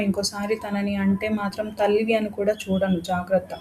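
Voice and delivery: ordinary delivery, nothing marked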